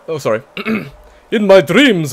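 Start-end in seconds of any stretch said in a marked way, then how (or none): 1.3-2.1 slow, louder dramatic vampire. Acted. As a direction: start the villain monologue in vampire voice. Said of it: "That was my best vampire voice".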